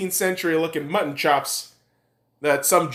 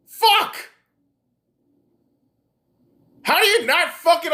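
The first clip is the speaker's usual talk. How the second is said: raised, high-pitched angry rant; angry outburst; 9 semitones higher than usual. Real, not acted. Ranter berates doubters about corporations ensnaring them, angry outburst. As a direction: tear into them, voice pushed high.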